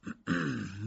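short preparatory throat clear. Acted. Accused K about to answer the magistrate, clearing his throat. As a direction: clear your throat before replying.

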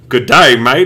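loud, hammed-up Aussie greeting; exaggerated Australian drawl; mock Australian accent. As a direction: put on a joking Australian accent. Mock Australian after accent mix-up.

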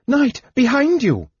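very high, urgent cry; screamed warning. A narrator's reading. Woman warns the knight of danger behind, screamed warning. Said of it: "The woman screamed".

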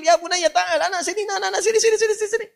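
very high, urgent mock summons; calling out. Guru summons the young man over, high excited calling. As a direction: call him over in a high-pitched, repeated cry. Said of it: "lihat anak muda tadi dipanggil".

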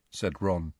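usual speaking voice

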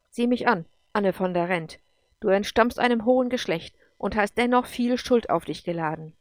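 loud, commanding pronouncement; imperious, emphatic accusation; stern judge. Acted. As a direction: deliver a forceful verdict.

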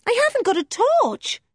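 put-on a frightened girl desperate to help; girl pleading through the door; distressed young girl. Joe voiced.

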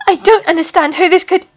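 words fail mid-sentence; panicked Rigel. A narrator's reading. Student panics before Snape, voice failing.

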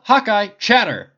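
scolding shout. Acted. The captain cutting off Hawkeye's joke mid-fight, sharp shout. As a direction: cut him off hard.

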